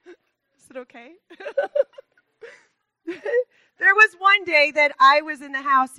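real tears breaking through a laugh; fighting back tears, pushing on; emotional laugh-cry mid-talk; choked up. Real, not acted. Tearing up while speaking to church.

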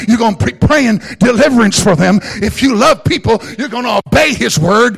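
full-force preaching. Real, not acted. Pastor to the congregation, full-force preaching. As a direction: preach it out to the whole house.

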